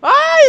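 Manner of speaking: very high, loud shriek; startled scream. Real, not acted. Gamer attacked in game, shrieking. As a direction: shriek at the hit.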